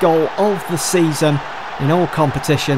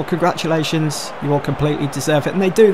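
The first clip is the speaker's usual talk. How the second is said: normal talking voice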